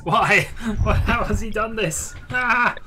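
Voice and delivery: shocked computer. Acted. Computer AI panicking at the news, mock shock. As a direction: cry out in mock alarm.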